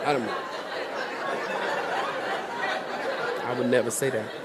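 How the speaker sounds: very low, quiet aside; hushed, deadpan denial; mock-innocent disclaimer. Real, not acted. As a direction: disown the joke under your breath. Mock disowning after quoted joke.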